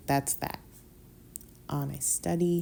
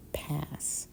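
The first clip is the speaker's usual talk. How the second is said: whispered; 8 dB quieter than usual. Real, not acted. Curt refusal, whispered.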